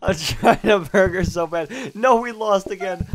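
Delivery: hyped gamer bro. Real, not acted. Lagging in cooking game.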